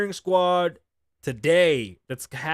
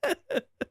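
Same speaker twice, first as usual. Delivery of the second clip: very high burst of laughter; squealing giggle. Real, not acted. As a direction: laugh helplessly in falsetto. Laughing at son's joke.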